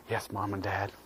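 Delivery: soft breathless whisper; whispered yes. Acted. Boy finally telling parents his news, whispered. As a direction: hush it like a secret.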